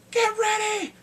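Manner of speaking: high, loud mock battle cry; shouted warning. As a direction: call out the alarm to the troops. Acted battle warning called out.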